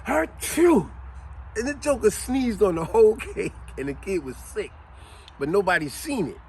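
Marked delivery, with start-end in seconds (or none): 0.1-0.9 big imitated sneeze, high; loud fake sneeze. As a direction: blast out a loud mock achoo. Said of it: "He went like this".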